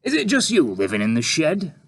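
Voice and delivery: oozing fake praise; sarcastic reporter. A narrator's reading. Reporter character.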